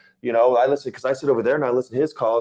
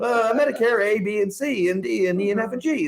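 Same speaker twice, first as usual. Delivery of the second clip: higher, nervous listing of plans; rattling rookie agent; 9 semitones higher than usual. Retold or imitated. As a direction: recite the letters unsure. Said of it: "You don't really sound like one".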